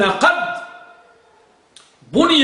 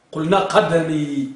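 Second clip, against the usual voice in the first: ordinary delivery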